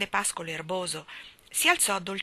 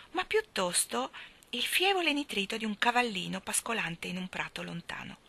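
ordinary delivery, nothing marked

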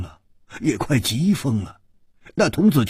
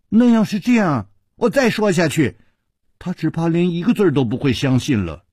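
lightly voiced boy messenger, pitched up; storybook child servant, teasing; 7 semitones higher, 4 dB louder than usual. A narrator's reading. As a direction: play a knowing young page, toying with the listener.

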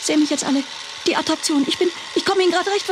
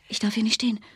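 tense, hushed whisper; frightened whisper while hiding; 7 semitones lower, 6 dB quieter than usual. Acted. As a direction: breathe it so nobody hears. Fleeing in panic, hiding.

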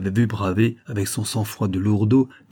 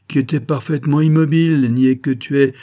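loud, high, emphatic correction; master's scornful outburst; exasperated rebuke; 5 semitones higher, 7 dB louder than usual. A narrator's reading. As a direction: put the fool right forcefully. Master rebukes the foolish servant.

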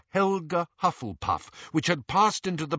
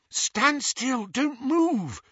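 high, urgent warning; alarmed; 11 semitones higher, much slower than usual. Acted. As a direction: freeze him with alarm. Hermione alarmed, clutching Ron.

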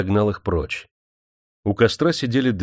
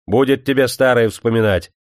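loud, raised-pitch rebuke; irritated scolding, building higher and louder; angry; 6 semitones higher, 6 dB louder than usual. A narrator's reading. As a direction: cut off the reminiscing crossly. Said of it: "сердито сказал он".

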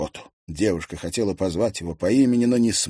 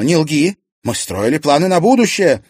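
loud, higher outcry; angry shout; 9 semitones higher, 9 dB louder than usual. Acted. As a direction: shout him down. Serafima shouts at Klim. (гневно, громко)

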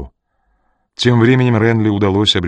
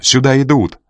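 high, raised warning; urgent cry; 5 semitones higher, 4 dB louder than usual. A narrator's reading. Жанна warns that someone is coming, crying out. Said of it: "закричала Жанна".

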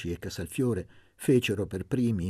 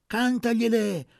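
high, loud call; women urge Luca on, high and loud; cheering; 8 dB louder than usual. A narrator's reading. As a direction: shout the encouragement.

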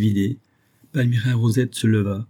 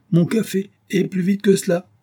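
high-pitched peremptory demand; impatient order; 10 semitones higher, 3 dB louder than usual. A narrator's reading. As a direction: order your servant to hurry. Professor orders servant about.

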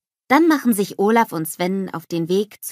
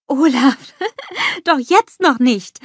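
high, loud amused chiding; laughing; 7 semitones higher, 7 dB louder, slower than usual. A narrator's reading. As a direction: chide him slowly through a laugh. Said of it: "lacht Anna".

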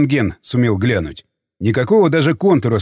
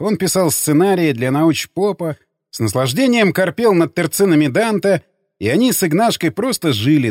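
plain reading voice

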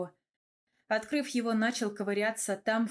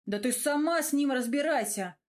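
plain reading voice

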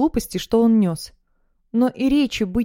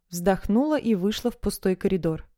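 ordinary delivery, nothing marked

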